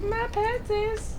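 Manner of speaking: high, slow, squeaky mock voice. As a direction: squeak it out in a silly falsetto.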